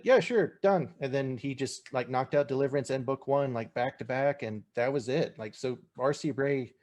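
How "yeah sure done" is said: eager. Retold or imitated. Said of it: "Luke was like".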